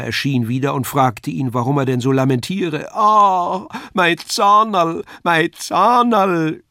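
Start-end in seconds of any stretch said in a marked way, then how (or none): 2.9-6.6 very high, loud, slow howl of toothache; wailing Kasperl. A narrator's reading. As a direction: howl over the aching tooth. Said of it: "schrie Kasperl". (klägliches Geheul, hoch und langsam)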